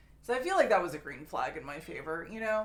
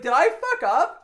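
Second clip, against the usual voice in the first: panicked question; 10 semitones higher, 11 dB louder than usual. Retold or imitated. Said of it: "and I was like".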